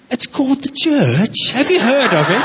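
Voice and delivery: a hint of a posh English pastor, amused; mild English accent for the punchline. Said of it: "But he's got this accent".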